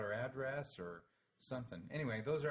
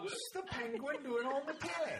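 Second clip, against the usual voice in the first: high, silly British-sketch take on Scottish; comic put-on Scottish squawk; attempted Scottish accent; 4 dB louder than usual. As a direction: have a go at a Scottish accent, pitched way up. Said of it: "Can you do a Scottish accent".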